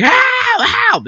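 very high-pitched imitated cry for help; mock screaming. Reviewer imitating the film's victim calling out, mock screaming. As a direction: squeal it like a panicked victim, without much volume. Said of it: "then he started screaming".

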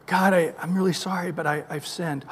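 soft, sheepish apology; a quiet, ashamed confession. Acted. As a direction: mumble it like a guilty child. Sinner confessing to God.

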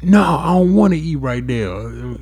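slow, high-pitched mock refusal; exaggerated grumpy refusal, drawn out high; mad, monstrous retort. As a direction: imitate a partner still mad. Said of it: "You make me sound like a monster".

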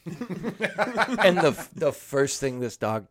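normal talking voice

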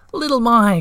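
high, loud cry of a name; happy cry. A narrator's reading. Moomintroll greeting Little My, crying out happily. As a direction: cry her name out again in delight. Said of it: "cried Moomintroll once again".

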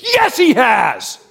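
high, drawn-out shout; imitated companion's emphatic yell; loud defence. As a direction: shout back on your friend's behalf. Companion shouts back for teller.

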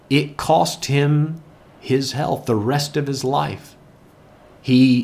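ordinary delivery

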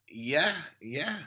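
normal talking voice

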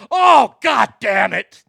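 dad cursing after his birdie is ruled a par; imitating dad's outburst. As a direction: bellow in disgust at the penalty. Dad learns of the penalty.

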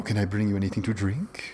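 a hint of a theatrical owner offering drinks; theatrical restaurant owner. Restaurant owner greeting diners, a hint of theatrical host. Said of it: "I'm doing an impression. This is how he talks".

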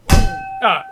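pained yelp. Acted. Hit by the frying pan.

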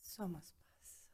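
soft slow whisper; whispered mantra. Real, not acted. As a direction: whisper it back calmly. Listener repeats mantra in meditation.

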